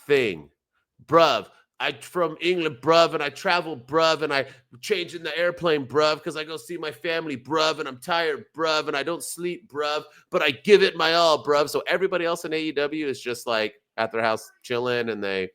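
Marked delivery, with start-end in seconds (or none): 1.0-11.7 mock British promo. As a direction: parody a Brit tacking bruv onto everything. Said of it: "six different promos recently about Passion".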